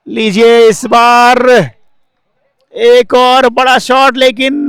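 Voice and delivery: high, loud and slow call; excited cricket commentary. Real, not acted. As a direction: build up the big shot. Big shot being hit.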